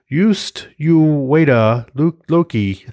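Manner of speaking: a bad mock Swedish Thor, drawn out high; terrible Swedish accent. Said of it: "That's a terrible Swedish accent".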